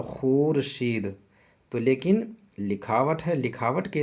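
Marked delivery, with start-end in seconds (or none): 0.0-1.1 खुर्शीद with long oo, khoor-sheed; spelled-out reading. Shown as a demonstration. Teacher reads the written form, खुर्शीद as slow khoor-sheed. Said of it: "लिखा हुआ है".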